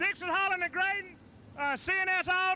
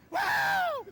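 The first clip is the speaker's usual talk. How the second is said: victory whoop; 14 semitones higher than usual. Real, not acted. Winning driver whooping to the crowd after his victory. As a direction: let the joy burst out. Winner celebrating in victory interview.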